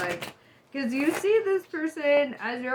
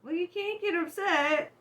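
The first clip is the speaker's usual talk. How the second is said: usual speaking voice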